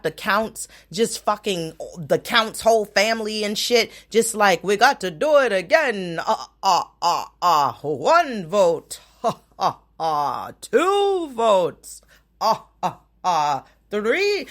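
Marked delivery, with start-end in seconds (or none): 4.6-14.5 mock recount voice. Mocking Trump camp recounting votes.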